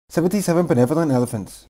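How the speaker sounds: rattled off at top speed; very fast. Shown as a demonstration. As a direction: blur the tongue twister together. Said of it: "Say the tongue twister faster and faster and faster".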